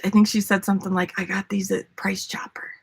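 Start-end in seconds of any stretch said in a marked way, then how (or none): 1.2-2.7 breathy whispered secret; secretive grandma whisper. Retold or imitated. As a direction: whisper it like a confession. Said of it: "And she whispered to me".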